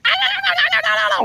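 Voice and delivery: very high monkey-like gibberish; imitating Abu's chatter. Showing listeners how his brother sounds, squeaky monkey chatter. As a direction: squeal rapid monkey babble far above your voice. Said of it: "to you guys, it sounds like".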